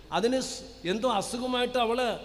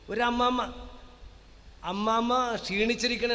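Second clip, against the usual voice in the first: usual speaking voice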